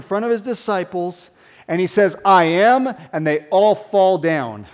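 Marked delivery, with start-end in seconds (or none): 2.2-2.9 louder, commanding I am; bold declaration. Acted. Jesus facing the arresting mob, loud and commanding. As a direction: let the name ring out.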